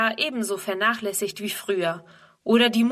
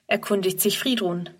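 plain reading voice

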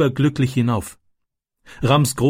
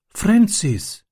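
put-on higher, hushed boy's voice calling up from the branch; higher young boy voice whispering a name; Ram's whispered call; 9 semitones higher than usual. A narrator's reading. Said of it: "flüsterte Ram".

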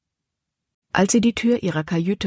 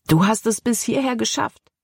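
excited; 6 semitones higher than usual. A narrator's reading. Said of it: "Begeisterung schwang in Calebs Stimme mit".

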